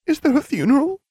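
high, tearful question with put-on grief; crocodile-tears voice, pitched high; mock-tearful. A narrator's reading. Friend asks about the headmaster's funeral, mock-tearful. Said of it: "all sniffles and crocodile tears".

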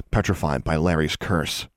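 usual speaking voice

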